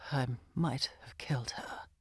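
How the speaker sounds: unvoiced, hushed admission; whispered confession. A narrator's reading. Brooding man to Anne, half to himself, whispering. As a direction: breathe it out like a guilty secret.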